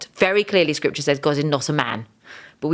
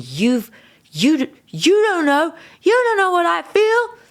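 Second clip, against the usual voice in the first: much higher, louder cry of anguish at Jesus; angry outburst; 13 semitones higher, 6 dB louder than usual. Retold or imitated. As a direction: hurl it at him, voice cracking high. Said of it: "And I got angry".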